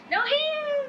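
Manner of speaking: high delighted cheering; excited cheer. Real, not acted. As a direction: cheer like at a rodeo. Cheering a ride.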